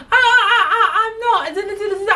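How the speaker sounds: high, stammering fake-cry; mock tearful protest. Accused shop worker denying it, mockingly tearful. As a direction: mimic a worker blubbering her denial. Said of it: "start bursting out in tears".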